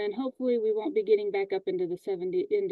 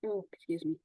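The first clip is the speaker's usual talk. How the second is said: stifling a sneeze, quiet and tight; holding back a sneeze; 3 semitones lower, 8 dB quieter than usual. Real, not acted. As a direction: talk while fighting off a sneeze. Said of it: "I'm trying not to sneeze".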